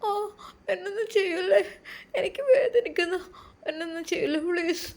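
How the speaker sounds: very high, tearful wail; acted sobbing plea; crying in pain. A narrator's reading. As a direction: whimper and beg through pain. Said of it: "നീ എന്തിനിങ്ങനെ കരയുന്നത്?".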